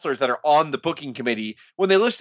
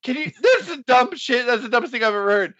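higher-pitched mock protest, speeding up; high mock-exasperated objection, rushing by the end; imagined intern protest; 6 semitones higher than usual. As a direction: play an indignant intern challenging the boss. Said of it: "an intern".

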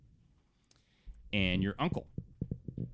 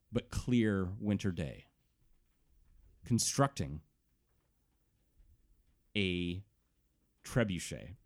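usual speaking voice

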